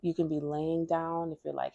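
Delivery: usual speaking voice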